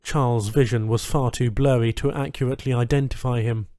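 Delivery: usual speaking voice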